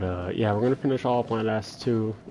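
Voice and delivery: ordinary talk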